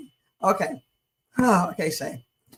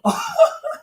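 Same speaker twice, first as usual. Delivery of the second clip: high squeal of laughter. Real, not acted. Reacting to funny video.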